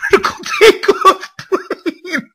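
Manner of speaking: loud reading dissolving into laughter; last word squeaks up into a laugh; laughing while reading. Real, not acted. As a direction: lose it on the punchline. Reading a gory line aloud.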